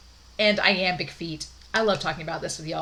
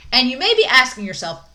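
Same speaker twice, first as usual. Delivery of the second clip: normal talking voice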